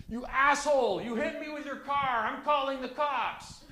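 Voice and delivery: very high, squawky mock outrage without much volume; mock his whiny outrage way up high, slowing at the end; imitating angry cyclist. Cyclist accusing the driver, high-pitched mocking imitation. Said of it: "and he's like".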